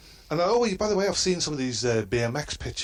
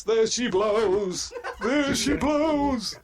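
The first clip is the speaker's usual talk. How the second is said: slow, drawn-out high mock yell, much higher and louder; mock shout; 14 semitones higher, 4 dB louder, much slower than usual. Neighbours yelling over the fence, slow mock shout. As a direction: bellow it like a whaler spotting a whale. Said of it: "neighbours kept shouting over the fence".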